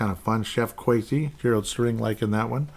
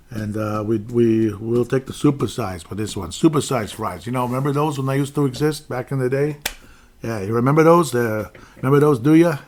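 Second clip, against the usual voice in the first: louder New York voice with a pushy edge; teasing New York diner voice; New York accent; 5 dB louder than usual. Retold or imitated. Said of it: "I like to play with my voice".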